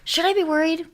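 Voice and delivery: high, anxious asking voice; worried mock voice. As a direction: ask it like a nervous boyfriend. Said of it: "when people say".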